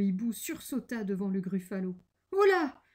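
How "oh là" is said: high, loud yelp of alarm; startled exclamation. A narrator's reading. The owl starts before the gruffalo, startled exclamation. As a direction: jump with acted fright. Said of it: "s'exclama-t-il".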